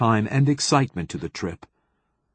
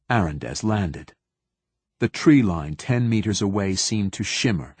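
ordinary delivery, nothing marked

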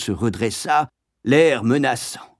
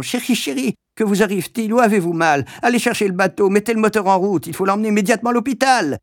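cris aigus et pressés d'une fiancée paniquée; elle s'affole pour son futur mari, de plus en plus vite; cri affolé; 9 semitones higher, faster than usual. A narrator's reading. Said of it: "en criant". (la grande sœur crie, affolée, à son fiancé)

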